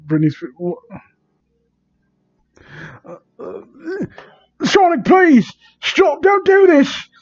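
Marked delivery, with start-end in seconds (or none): 4.6-7.1 much higher hammed-up cry for help; mock screaming plea. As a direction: screech the plea in mock terror. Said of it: "I screamed on top of my lungs".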